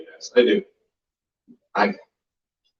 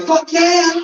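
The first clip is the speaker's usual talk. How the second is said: eager yes; 13 semitones higher than usual. Retold or imitated. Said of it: "And I was like".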